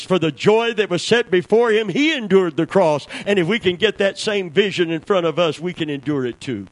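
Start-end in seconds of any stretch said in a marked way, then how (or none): 0.0-4.9 preaching at full force. Real, not acted. Preaching climax.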